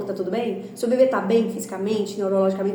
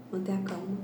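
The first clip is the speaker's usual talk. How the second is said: softer, hushed echo of "Manter a calma"; quiet repeat; 3 semitones lower, 9 dB quieter than usual. Shown as a demonstration. (repetição baixinha de "Manter a calma")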